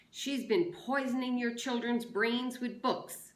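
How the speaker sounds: loud indignant proclamation; declaring. A narrator's reading. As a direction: announce it to the mob. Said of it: "Trout declared".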